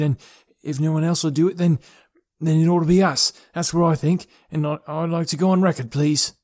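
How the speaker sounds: faltering. A narrator's reading. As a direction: grope for the words, restarting as you go. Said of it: "he began falteringly".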